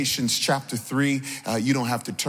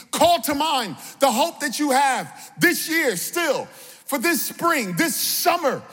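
high, rousing sermon delivery; impassioned preacher's call; preaching; 10 semitones higher than usual. Real, not acted. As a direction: exhort the congregation in rhythmic, falling phrases. Preaches with raised voice.

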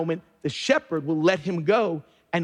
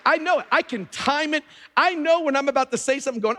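pitched up, rising fervor; excited preaching; 7 semitones higher, faster than usual. Real, not acted. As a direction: call it out to the church, fired up. Preacher calling out the congregation.

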